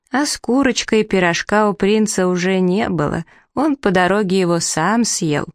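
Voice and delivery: usual speaking voice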